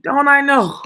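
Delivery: emphatic rhetorical shout; loud rhetorical. Real, not acted. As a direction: shout it out as if it's obvious.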